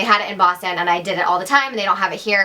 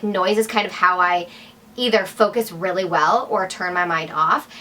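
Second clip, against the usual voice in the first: usual speaking voice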